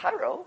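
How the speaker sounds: "Chairo" very high and soft; a squeaky-high, soft "Chairo"; exaggerated foreign pronunciation. Shown as a demonstration. Preacher joking with the original word, high soft pronunciation. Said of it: "not trying to do an Oriental impersonation".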